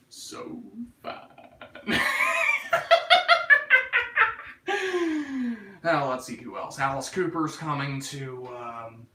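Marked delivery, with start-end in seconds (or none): 1.6-5.8 high laugh. Real, not acted. Streamer cracking up at his own stoner bit, real laugh.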